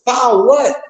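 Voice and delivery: high-pitched, incredulous comeback; incredulous challenge. Real, not acted. Answering people who push filings, incredulous challenge.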